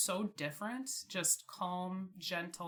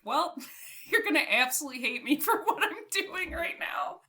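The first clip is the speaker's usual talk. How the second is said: giggling, higher and louder; laughing confession; 9 semitones higher, 7 dB louder than usual. Retold or imitated. To her mom on the phone about the new dog, laughing. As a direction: laugh nervously as you confess.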